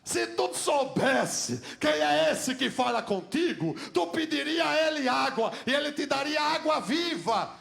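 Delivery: preaching the promise of living water; fiery preaching. Acted. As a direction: proclaim it to the crowd. Preaching Jesus' words.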